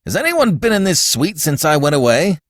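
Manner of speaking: high, urgent demand; wild questioning. A narrator's reading. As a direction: question a servant in agitation. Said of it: "turned to him wildly".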